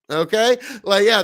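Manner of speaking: ordinary talk, nothing marked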